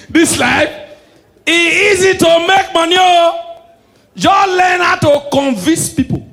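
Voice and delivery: high, loud punchline; loud high comic punchline; excited shout. Real, not acted. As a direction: yell it to the crowd, slowly at the end. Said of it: "punchline yelled".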